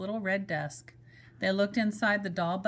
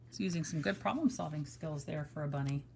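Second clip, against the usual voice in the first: aside; 5 semitones lower, 6 dB quieter, faster than usual. Real, not acted. Reader's aside to listeners.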